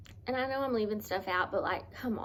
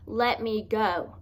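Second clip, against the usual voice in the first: retold plea; 2 semitones higher, 7 dB louder, much slower than usual. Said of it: "screaming and crying".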